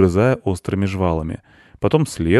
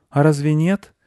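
put-on high boy's question; high-pitched young boy asking gently; little boy; 9 semitones higher, 4 dB louder than usual. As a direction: ask in a high child voice.

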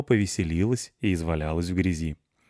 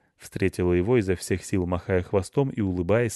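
ordinary delivery, nothing marked

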